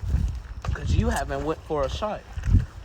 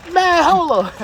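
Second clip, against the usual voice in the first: high, loud hold-on protest; indignant; 12 semitones higher, 8 dB louder than usual. Acted. Player protesting the coach's insult, indignant. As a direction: stop everything in disbelief.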